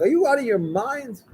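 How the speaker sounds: high, indignant outburst from the husband; husband bursts in on his wife making soup; retold shout. Husband shouts at wife over matzah balls. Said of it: "He's shouting".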